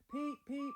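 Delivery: high, loud whistle call; engine whistle peep. A narrator's reading. Little engine Edward whistling proudly as he sets off, high whistle peep. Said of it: "whistled Edward".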